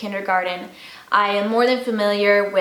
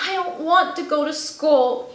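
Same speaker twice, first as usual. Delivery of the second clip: put-on pleading child voice; 7 semitones higher than usual. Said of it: "Chester pleads to stay home".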